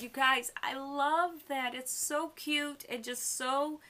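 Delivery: fond, slowed gush of delight. Real, not acted. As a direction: melt over how cute it is. Gushing over the scene.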